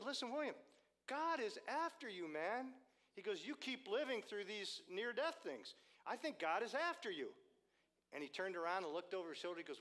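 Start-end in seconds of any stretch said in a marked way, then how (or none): 0.0-2.7 high, loud earnest urging; urgent pastor. Retold or imitated. Pastor to William after his recovery, high and urgent. 3.5-7.3 high, insistent retold plea; urgent pastor. Pastor to William about near-death escapes, high and urgent.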